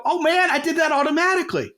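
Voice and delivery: high, thrilled inner voice; your brain suddenly catching itself being funny; excited realization. Retold or imitated. Listener's brain realizing it joked on autopilot, excited high voice. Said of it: "you're gonna feel something in your brain go".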